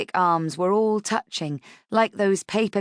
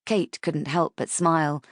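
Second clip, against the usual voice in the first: usual speaking voice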